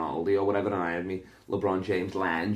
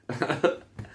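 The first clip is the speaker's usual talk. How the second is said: high laugh; 7 semitones higher than usual. Real, not acted. Laugh after bit.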